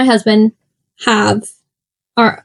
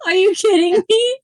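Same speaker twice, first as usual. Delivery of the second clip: laughing; 10 semitones higher than usual. Real, not acted. Friend can't name account types.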